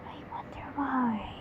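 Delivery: a soft whispered aside. Real, not acted. Reader whispering a wry aside to viewers. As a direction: whisper it to yourself.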